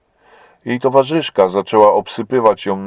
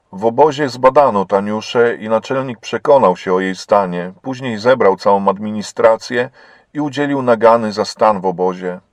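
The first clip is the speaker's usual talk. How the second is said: ordinary delivery, nothing marked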